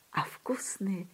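very slow, near-whispered relish; soft, drawn-out storybook ending; hushed savouring. A narrator's reading. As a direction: drop almost to a whisper and linger on each word. Kid savours imagined cream, story's end.

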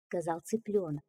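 usual speaking voice

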